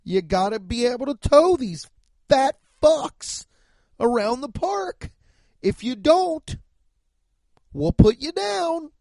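high, loud hammed-up horse-owner bark; mock horse boss. Comic mocking carriage owners, high loud mock bark. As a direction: play the cruel carriage boss yelling at horses.

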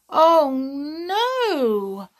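high, loud wail of dismay; dismayed cry. A narrator's reading. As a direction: cry out at awful news. Tom hears Sally got worse.

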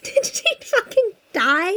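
slow incredulous drawl. Retold or imitated. Mock outrage.

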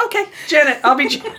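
laughing surrender. Retold or imitated. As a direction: give in with a laugh. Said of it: "I was like".

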